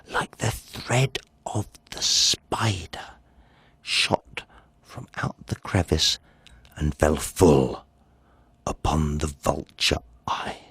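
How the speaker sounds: soft, hushed whisper; stealthy whisper. A narrator's reading. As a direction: whisper it like creeping in the dark. Stealthy lantern ray moment.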